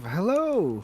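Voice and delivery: high, loud, cheery call; big friendly hello; friendly greeting. Acted. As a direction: call out with an oblivious smile. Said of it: "gives a friendly smile and wave".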